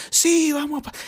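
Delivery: high, eager cheer, cut off; hammed-up joyful start; mock excited. Imitating the happy congregation, mock excited.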